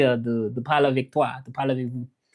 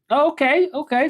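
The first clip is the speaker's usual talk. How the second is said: excited; 11 semitones higher than usual. Real, not acted. Reacting to viewer comment.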